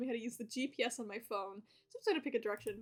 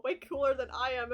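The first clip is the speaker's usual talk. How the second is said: ordinary talk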